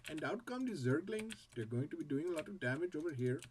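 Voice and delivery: game caster with an Indian accent. Shown as a demonstration. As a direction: commentate quickly in an Indian accent. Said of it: "an entire game in an Indian accent".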